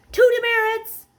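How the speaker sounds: high, loud stern teacher; mock teacher bark. Luke mimicking the teachers, loud high bark. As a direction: snap it like a strict teacher.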